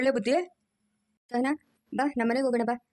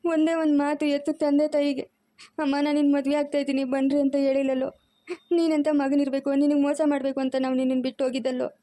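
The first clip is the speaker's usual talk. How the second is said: crying; 2 semitones higher than usual. Acted. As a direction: sob out the reproach. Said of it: "ಅಳ್ಬಾರ್ದು".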